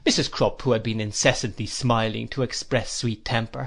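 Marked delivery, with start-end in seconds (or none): none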